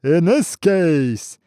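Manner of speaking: high, loud, slow chant of scripture; slow booming recitation; roaring voice. Acted. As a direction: roar the verse at his back. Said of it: "roaring at his back".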